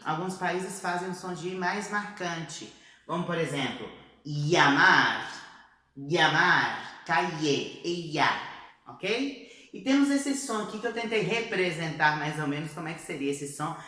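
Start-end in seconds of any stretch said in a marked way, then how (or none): 4.2-8.3 llamar, calle, ella with ll said as a plain i; ee sound. Shown as a demonstration. Teacher models the i-sound ll for students. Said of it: "Temos também o som de I".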